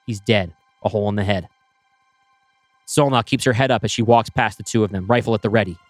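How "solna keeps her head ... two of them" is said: plain reading voice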